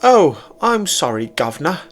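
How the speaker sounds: Celine's hammed-up mock British accent, pitched high; bad British accent. A narrator's reading. Celine mocking the captain in a poor British accent. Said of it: "poorly imitating my British accent".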